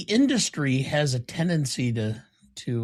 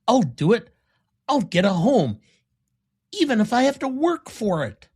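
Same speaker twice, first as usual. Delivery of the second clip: earnest vow; 9 semitones higher, 5 dB louder than usual. Retold or imitated. Quoting movie character resolve.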